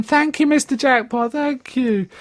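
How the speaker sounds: put-on high, grateful old-lady voice; old lady thanks. Old lady thanking Mister Jackpot, high and grateful. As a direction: chirp your thanks like a little old woman. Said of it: "she's going".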